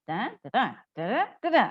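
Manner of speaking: each "da" sung out as a pitch step, up then down; up-and-down intonation demo. Shown as a demonstration. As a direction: model the tune with "da, da, da". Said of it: "harus ada intonasi naik turunnya". ("da, da, da" dengan nada naik turun)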